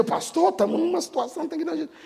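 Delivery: high, quick urgent plea; anxious treasurer. Retold or imitated. Church treasurer urging the pastor to fix finances, high and hurried. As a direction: press the pastor like a worried bookkeeper.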